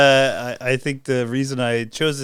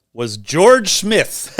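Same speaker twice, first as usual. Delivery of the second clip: slow dramatic reveal; 3 semitones higher, 4 dB louder, much slower than usual. Acted. Dramatic reveal of horse name.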